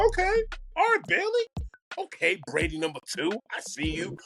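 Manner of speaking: high, excited "okay, Bailey"; pumped up seeing a new star; hyped-up inner voice. Retold or imitated. Fan hyped watching the quarterback, excitedly high. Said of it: "'cause I was like".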